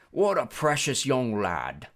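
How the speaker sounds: slow drawn-out British character voice; British-like accent. A narrator's reading. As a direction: put on an odd, foreign-tinged British accent. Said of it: "He had a strange accent. British maybe".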